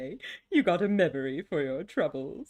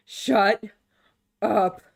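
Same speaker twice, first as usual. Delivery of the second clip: snapping shut up; 7 dB louder than usual. Real, not acted. Snapping at Loop.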